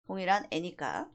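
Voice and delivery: usual speaking voice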